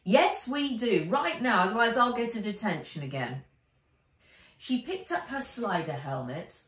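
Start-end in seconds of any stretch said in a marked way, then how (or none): 0.0-3.4 louder, higher hurry; insistent, rushing at the end; urgent Wen. A narrator's reading. Wen hurries Henry off to school, louder urgent. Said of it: "Wen jumped down off her stool".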